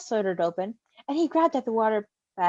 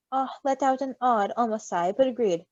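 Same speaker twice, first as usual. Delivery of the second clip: ordinary delivery, nothing marked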